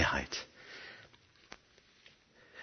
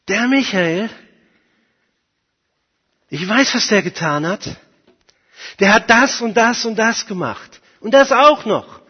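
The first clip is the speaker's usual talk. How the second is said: raised, higher voice, building louder to the last charge; accusing; 7 semitones higher, 5 dB louder than usual. Acted. Satan accuses a believer before God's throne, loud and indignant. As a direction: pile up the charges, louder each time.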